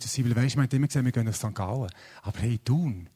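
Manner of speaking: normal talking voice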